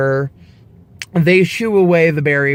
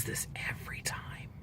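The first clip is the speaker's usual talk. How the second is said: whispered aside; 22 dB quieter than usual. Retold or imitated. EMT confides about the doctor.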